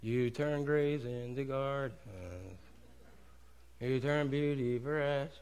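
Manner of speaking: soft, slow mock hymn; bored worshiper mumbling softly; dull rote worship. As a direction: flatly mouth the song lyrics. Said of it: "He doesn't want us".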